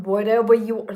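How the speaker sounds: ordinary talk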